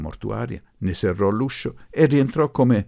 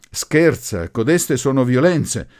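loud, higher accusation; indignant protest; 7 semitones higher, 10 dB louder, slower than usual. A narrator's reading. Groom's relative accusing the professor of violence, indignant. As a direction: protest hotly.

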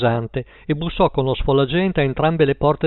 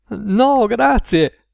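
put-on high, shrill child's refusal; 8 semitones higher, 4 dB louder, much slower than usual. A narrator's reading. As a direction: refuse in a high, strident squeak. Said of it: "disse Paul con voce stridula". (voce stridula e acuta)

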